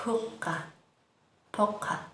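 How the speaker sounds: poca said softly with a gentle p; soft p, quiet. Shown as a demonstration. Teacher demonstrating a gentle p in poca quietly. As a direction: breathe out poca, p barely popped. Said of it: "No es tan completo el explosivo".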